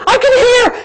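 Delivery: high-pitched cry of amazement; high excited exclamation; imitated joyful yelling. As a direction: imitate an overjoyed girl calling out. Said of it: "And she's like".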